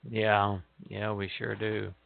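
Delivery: ordinary talk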